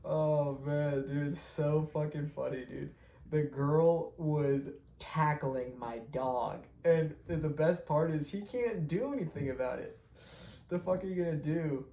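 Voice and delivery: amused retelling while still laughing; laughing while talking. Real, not acted. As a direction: talk through leftover giggles.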